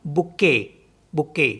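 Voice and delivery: बुके with the final T silent; French bouquet, no T at the end; French pronunciation. Shown as a demonstration. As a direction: say बुके ending on the vowel. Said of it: "इसको भी नहीं पढ़ेंगे".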